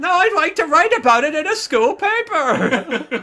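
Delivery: loud, high hammed-up accusation; mock high-pitched tabloid reporter; shrieking girl reporter. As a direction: squeal it loudly, half laughing. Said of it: "this is a perfect voice for her".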